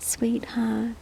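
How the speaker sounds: soft breathy sweetheart; whispered endearment. Real, not acted. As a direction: whisper it tenderly. Whispered to cat.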